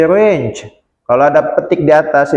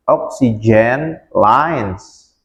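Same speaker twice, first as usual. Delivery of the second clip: Oxygen lines said very slowly, lines drawn out; slow model; 3 semitones lower, much slower than usual. Shown as a demonstration. Teacher to students, modelling Oxygen lines for repeating.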